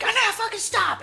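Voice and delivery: loud, high-pitched mad yell; retold angry outburst. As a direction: explode in mock fury to shut someone up. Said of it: "he gets mad".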